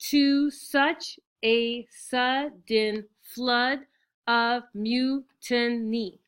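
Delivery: "To such a sudden flood of mutiny" very slow, loud, every syllable separate; syllable-by-syllable scansion. Shown as a demonstration. Teacher showing students the line has ten, syllable-by-syllable scansion. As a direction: chop "sudden" and "mutiny" into single syllables, each high and stretched. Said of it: "Again, there's ten".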